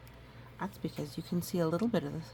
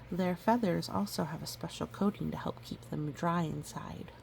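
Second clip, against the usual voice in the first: usual speaking voice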